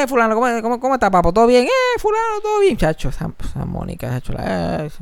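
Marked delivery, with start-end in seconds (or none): 0.0-2.8 high, loud mock greeting; imitated over-the-top hello; exuberant greeting. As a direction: call out heartily to a passing buddy. Acts out people greeting loudly.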